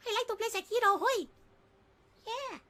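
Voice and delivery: put-on very high little-kid voice; small child. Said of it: "trying to impersonate a small child".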